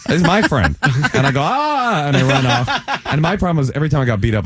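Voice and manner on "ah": mock pained yelp. Punched by drunk friend, runs off.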